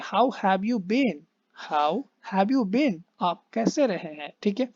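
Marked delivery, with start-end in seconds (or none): 0.0-3.0 slow, falling on been; English intonation demo. Shown as a demonstration. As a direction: let the question settle down on been. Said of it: "English में ऐसे बोलते हैं".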